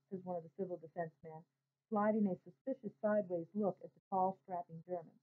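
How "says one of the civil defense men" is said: ordinary delivery, nothing marked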